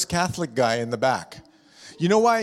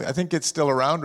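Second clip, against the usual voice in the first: normal talking voice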